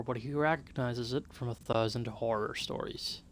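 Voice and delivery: normal talking voice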